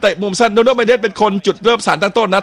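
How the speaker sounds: replay breakdown. Real, not acted. Replay commentary.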